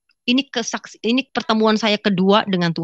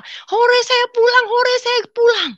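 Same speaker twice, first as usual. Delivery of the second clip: put-on much higher, happy child's hooray; joyful cheer; 14 semitones higher, 3 dB louder, slower than usual. The dreamer cheering about going home, joyful. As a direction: cheer like a kid let out of school. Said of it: "Saya gembira".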